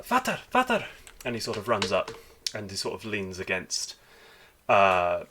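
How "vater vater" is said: put-on high, loud, light child's voice; young dwarf boy. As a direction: call out like a little dwarf boy. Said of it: "it's like a light voice".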